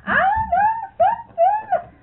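high, loud, laughing sing-song; old woman bursting to tell her secret; gleeful secret. Retold or imitated. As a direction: tease with a secret, squealing with glee. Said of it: "she says".

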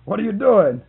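startled boss booming across the bank. Retold or imitated. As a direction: boom it out like an angry bank president. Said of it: "I heard this voice boom out".

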